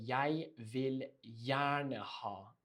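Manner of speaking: slow, careful polite request; slow polite demo. Shown as a demonstration. Teacher modelling a polite order, slow and careful. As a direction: spell it out slowly for learners. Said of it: "the polite way, even more polite".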